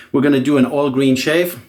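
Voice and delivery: normal talking voice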